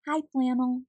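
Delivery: plain reading voice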